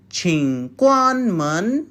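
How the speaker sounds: 请关门 said slowly for the class; slow and clear, for repetition. Shown as a demonstration. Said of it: "Yes, once more".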